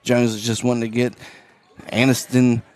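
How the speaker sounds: play-by-play. Acted. Announcer calling the late fourth quarter, livelier play-by-play. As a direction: ride the action up.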